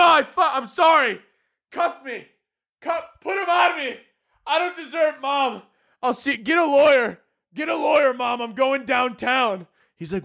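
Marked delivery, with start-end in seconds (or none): 0.0-5.2 hammed-up panic. Overdramatic surrender to agent. 5.2-9.7 mock yell to mom. Calling to mom, mock arrest.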